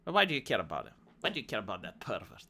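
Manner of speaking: low, softened brush-off; mock dismissive. Host imitating a politician dodging a reporter, dismissive. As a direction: drop low and wave the question away.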